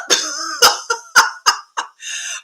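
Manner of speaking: long, high-pitched giggling fit; high laughter. Real, not acted. Laughs at her own description.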